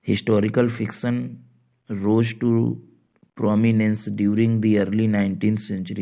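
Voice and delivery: English definition read very slowly; slow dictation. Shown as a demonstration. Teacher dictates the English definition slowly to students. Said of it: "reads definition slowly".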